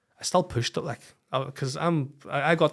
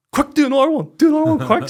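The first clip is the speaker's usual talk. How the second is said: much higher, louder and urgent; frantic excitement; 14 semitones higher, 13 dB louder than usual. Retold or imitated. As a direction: rush her to check again. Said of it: "I go".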